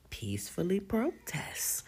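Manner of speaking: whispered aside. Real, not acted. Whispering to listeners about the protest. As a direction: drop to a breathy whisper.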